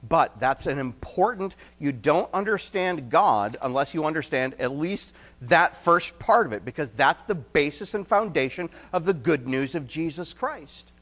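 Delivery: ordinary talk, nothing marked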